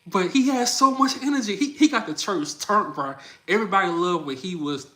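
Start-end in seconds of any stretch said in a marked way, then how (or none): 0.1-3.1 excited praise. Real, not acted. Hyping the uncle.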